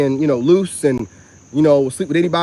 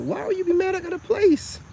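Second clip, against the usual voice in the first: much higher and softer, a put-on disbelieving voice; mock incredulous; 4 dB quieter than usual. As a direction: play it high and wide-eyed, as if it makes no sense. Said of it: "I'm like".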